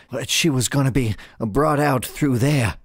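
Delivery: showman's voice giving up the answer; intimidated ringmaster. Acted. Ringmaster telling his intimidator where she was, flustered. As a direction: play a cowed circus boss.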